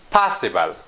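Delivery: "possible" stressed on POS, then dropping low; "possible" as POS-si-ble, a careful word demo; stress on first syllable. Shown as a demonstration. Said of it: "At the beginning".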